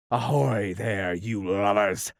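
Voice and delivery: mock pirate captain call; imitating Hook. Peter fooling the pirates in Hook's voice. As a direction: Peter doing his best Hook voice. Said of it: "imitated the voice of Hook".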